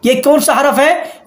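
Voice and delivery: normal talking voice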